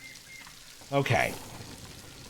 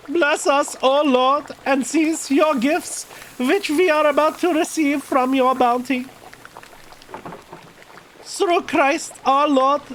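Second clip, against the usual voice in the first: put-on high, slow prayer with a German accent; grace in character; 10 semitones higher, slower than usual. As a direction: intone the blessing in a raised German-accented voice.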